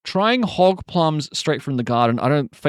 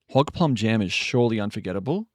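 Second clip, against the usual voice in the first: ordinary talk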